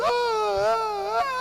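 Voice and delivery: high drawn-out mock wails of a crying child; imitated child crying. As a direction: wail like a small boy crying, stretched out. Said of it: "ficar chorando".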